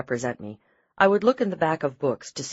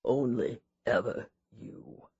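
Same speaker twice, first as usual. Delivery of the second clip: softer, slow last breath; faint dying words; 5 semitones lower, 8 dB quieter, slower than usual. A narrator's reading. Dying husband whispers his last words to his wife, soft and slow. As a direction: let the last words fade out.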